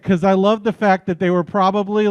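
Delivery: ordinary delivery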